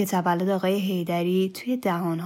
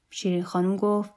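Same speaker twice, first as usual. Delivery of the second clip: ordinary delivery, nothing marked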